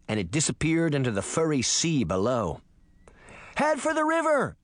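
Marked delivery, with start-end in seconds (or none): 3.5-4.6 high-pitched urgent call; urgent cry. A narrator's reading. Sabrina directing Puck mid-flight, crying out urgently. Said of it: "Sabrina cried".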